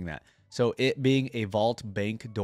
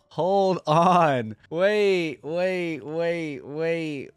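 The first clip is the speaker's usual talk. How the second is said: loud, high wait-wait, fading down each time; excited disbelief; 6 semitones higher, 6 dB louder, much slower than usual. Real, not acted. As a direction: stop yourself, amazed, then calm down. Excited by the changing shields.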